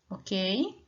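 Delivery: normal talking voice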